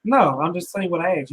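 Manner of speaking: usual speaking voice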